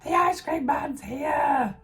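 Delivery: put-on high, loud child yelling for the ice cream van; mother imitating her kids calling about the ice cream van, loud high child shout; excited kid shout. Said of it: "my kids are gonna start screaming".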